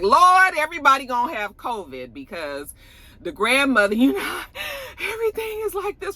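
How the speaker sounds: high, alarmed exclaiming; mock alarm. Viewer reacting to everyone hugging without masks, high mock alarm. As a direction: squeal in mock alarm about covid. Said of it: "I was just like".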